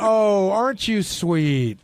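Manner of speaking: slow, syrupy mock-tender old-man voice; teasing a coworker's gushing serious voice; Sal's serious talk voice. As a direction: imitate his drawn-out sweet-talk voice. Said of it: "The voice where he goes".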